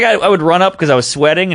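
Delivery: ordinary talk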